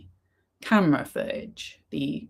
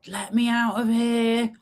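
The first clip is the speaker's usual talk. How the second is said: higher-pitched retold plea, not shouted; mock freaking out; 6 semitones higher than usual. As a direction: imitate a man panicking, lightly acted. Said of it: "He's proper freaking out".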